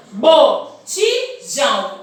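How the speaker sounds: Botijão split slowly into bo-ti-jão; syllable by syllable. Shown as a demonstration. Teacher spelling out syllables for her class, slow and split. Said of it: "separar conforme vocês falam".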